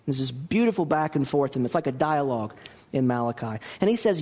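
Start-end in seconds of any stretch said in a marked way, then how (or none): none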